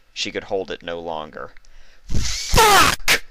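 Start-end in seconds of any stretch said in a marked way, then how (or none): 2.1-3.2 very high, loud pained yell. A narrator's reading. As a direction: scream out the pain. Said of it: "she yelled".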